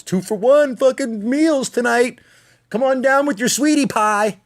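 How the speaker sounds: high, cheesy announcer parody; mock ad voice. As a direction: ham up the sappy Valentine commercial. Mocking Valentine's commercials.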